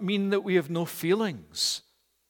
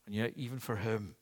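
softer, lower prayer voice; 6 semitones lower, 4 dB quieter than usual. Acted. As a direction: lead the congregation in quiet prayer. Closing prayer.

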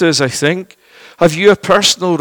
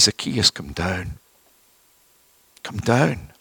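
gentle call; 9 semitones lower, 10 dB quieter than usual. Acted. Jesus calling Zacchaeus from the tree.

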